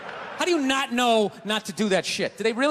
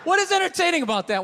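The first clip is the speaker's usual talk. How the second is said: rant; 7 semitones higher, 4 dB louder than usual. Real, not acted. Ranting about the show.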